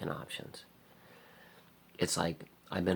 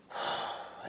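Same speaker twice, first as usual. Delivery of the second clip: weary sigh. Real, not acted. Sigh of frustration.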